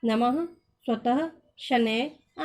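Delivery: नमः, स्वतः, शनैः each ending in a breath of ह; visarga with h sound. Shown as a demonstration. Teacher showing visarga words, each ending on an h sound. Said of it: "ह की ध्वनि के साथ होता है".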